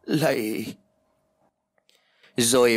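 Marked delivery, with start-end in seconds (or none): 0.0-0.7 drawn-out begging cry, understated; wailing plea. A narrator's reading. Fallen old woman begging the landlord, mild drawn-out wail. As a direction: beg on one long word. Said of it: "cố gắng kêu gào và van nài xin xỏ".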